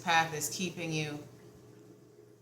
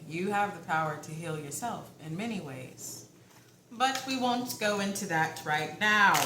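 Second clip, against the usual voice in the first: ordinary talk, nothing marked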